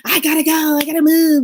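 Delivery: high-pitched mock rush of impatience; urgent energy. Mimicking the tailgating driver's energy, urgent and high. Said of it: "I could feel this energy of".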